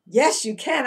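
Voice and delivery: ordinary delivery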